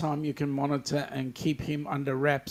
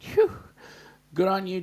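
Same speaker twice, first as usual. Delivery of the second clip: ordinary talk, nothing marked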